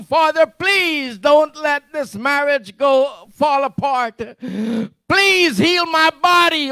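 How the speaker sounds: loud, high pleading cry to God; demonstrated desperate prayer. Shown as a demonstration. Preacher acts out Paul's pleading prayer, loud and high. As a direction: shout the prayer like a desperate believer begging. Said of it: "let me see if I can demonstrate it".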